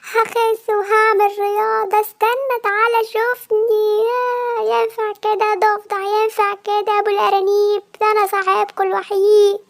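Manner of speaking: loud pleading call; calling after them. Acted. Fat cat begging leaving friends to wait, loud call.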